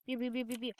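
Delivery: soft imitated beeps; mock beeping. Gamer mimicking a kitchen machine's soft beeps. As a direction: beep like a little machine.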